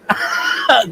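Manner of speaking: sudden high squeal of a laugh; high burst of laughter. Real, not acted. Laughing at the pun.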